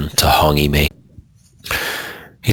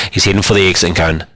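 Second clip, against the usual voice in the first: ordinary delivery, nothing marked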